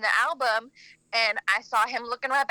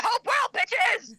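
high, joking shouted greeting; playful fan shout; mock shout; 7 semitones higher, much slower than usual. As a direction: yell it like a fan chant.

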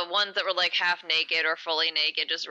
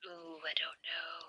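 whisper; 3 semitones lower, 16 dB quieter than usual. Retold or imitated. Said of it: "and I was like".

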